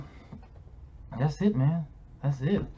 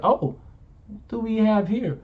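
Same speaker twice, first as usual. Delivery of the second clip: sly teasing; 7 semitones higher than usual. Retold or imitated. Teasing discovery quoted.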